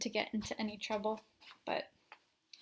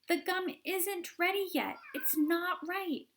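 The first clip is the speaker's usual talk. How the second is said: anxious Wonka; 9 semitones higher than usual. Acted. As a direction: fret and warn. Wonka worried.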